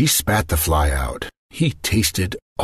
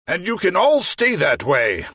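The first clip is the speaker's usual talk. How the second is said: mock yell; 12 semitones higher than usual. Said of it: "the magician yelled".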